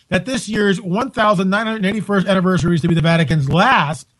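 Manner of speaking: ordinary delivery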